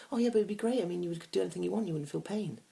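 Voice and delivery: high, quick imitation of the date; date protesting. Date defending her superpower choice, imitated high.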